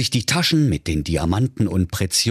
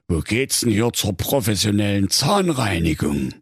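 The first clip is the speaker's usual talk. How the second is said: polite wolf. Acted. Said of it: "der fragte höflich".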